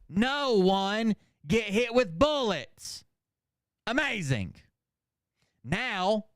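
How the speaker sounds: high, slow, declaimed read-out in separate chunks; mock haiku recital. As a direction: declaim each short phrase slowly with a pause after it.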